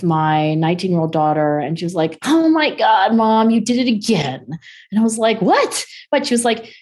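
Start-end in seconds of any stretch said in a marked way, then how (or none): 2.2-4.4 imitating an exasperated teen, higher pitched; exasperated daughter. Retold exasperated teenage daughter scolding mother. 5.4-5.9 high-pitched, rising retort; mock innocent surprise. Retold innocent reply to daughter's scolding.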